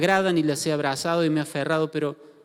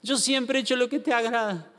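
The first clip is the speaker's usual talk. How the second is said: mock tearful plea; 8 semitones higher than usual. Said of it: "Se larga a llorar y dice".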